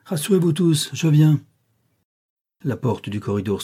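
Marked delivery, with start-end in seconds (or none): 0.1-1.4 high, raised call, growing louder; calling out reassurance. A narrator's reading. Rochester tells alarmed guests he is coming, calling out. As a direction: call down to the whole crowd.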